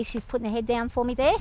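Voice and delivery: ordinary delivery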